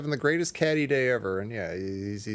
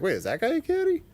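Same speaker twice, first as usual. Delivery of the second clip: lightly acted, higher-pitched double take; puzzled reaction; 11 semitones higher than usual. Viewer spotting the bearded older guy, puzzled. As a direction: question it in mild mock confusion. Acting out his own puzzlement.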